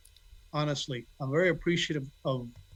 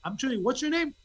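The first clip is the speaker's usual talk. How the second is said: raised, chirpy imitation of wife; outgoing wife; 8 semitones higher than usual. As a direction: pitch it up as a sociable introduction. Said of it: "she'll like".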